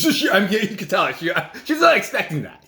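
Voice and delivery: laughing through the words; chuckling as he tells it. Real, not acted. Laughs while telling.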